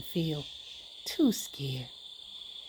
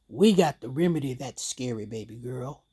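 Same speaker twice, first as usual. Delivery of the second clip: lightly voiced father, pitched lower; daddy voice; 7 semitones lower than usual. Daddy to his scared little girl, fatherly. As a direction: play daddy laying down a plan in a lower voice. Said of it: "He said".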